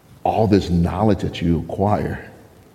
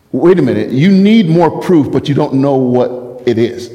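incredulous; 6 semitones higher, 5 dB louder than usual. Retold or imitated. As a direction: hit each word as if you can't believe it. Pastor reacting in disbelief.

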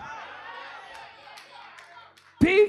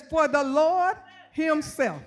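slow, weighty scripture reading; slower than usual. Shown as a demonstration. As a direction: lay each word down slowly. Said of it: "I gotta read it slow".

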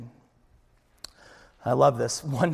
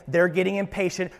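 ordinary talk, nothing marked

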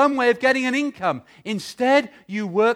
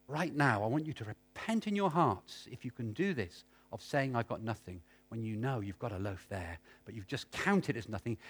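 quiet appeal; 12 semitones lower, 15 dB quieter than usual. Acted. Quiet call to repentance.

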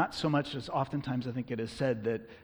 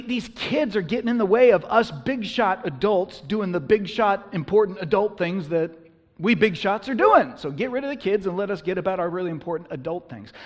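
loud, high exasperated big shot; pompous, irritated grumbling at raised pitch; self-important complaint; 6 semitones higher, 8 dB louder than usual. Acted. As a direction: shoo the kids away with mock importance. Voicing disciples dismissing annoying children.

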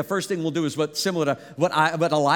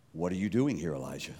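much lower and softer, a near-whispered question; hushed voice of God; 11 semitones lower, 7 dB quieter than usual. Acted. God's voice asking Elijah, low and hushed. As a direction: ask it low like a still small voice.

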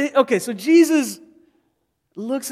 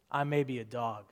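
softer, lower, humble but firm; quiet ownership; 8 semitones lower, 9 dB quieter than usual. Retold or imitated. Woman answering Jesus from the dust, quiet and firm. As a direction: own the insult quietly. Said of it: "she owns that insult".

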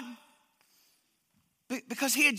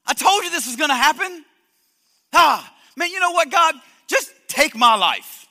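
loud, higher, exasperated rant at God; angry Jonah; 5 semitones higher, 8 dB louder than usual. Acted. Preacher voicing Jonah yelling at God in anger. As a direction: fume at God like a sulking prophet. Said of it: "look at his response to God".

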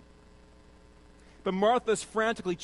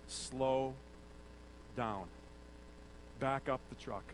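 much lower, softer, easy-going; calming rebuke; 6 semitones lower, 11 dB quieter, slower than usual. Retold or imitated. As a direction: ease someone down gently. Jesus calming Martha.

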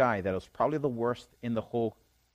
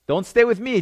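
raised, higher-pitched command as Jesus; commanding quote; 9 semitones higher, 8 dB louder than usual. Acted. Jesus sends the healed man away, loud commanding voice. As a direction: send him off loud and firm.

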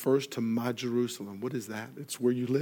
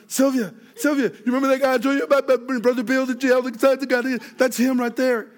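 much higher and louder, rushed and frantic; a panicked tip-off, racing the words; urgent alarm to wife; 12 semitones higher, 10 dB louder than usual. Retold or imitated. Husband urgently warning Sylvia the man is there, high frantic.

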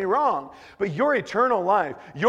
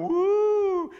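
long high-pitched "woo"; sustained high whoop, held on one note; imitated "woo"; 3 dB louder than usual. Preacher imitating a scary ride, high drawn-out whoo.

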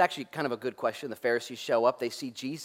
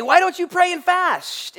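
much higher, louder mocking question; mocking whine; 13 semitones higher, 7 dB louder, slower than usual. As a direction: squeal it out to mock the critics. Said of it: "They say".

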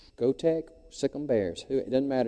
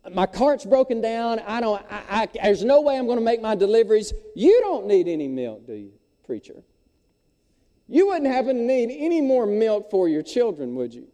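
frustrated milkman; 8 semitones higher, 3 dB louder than usual. Acted.

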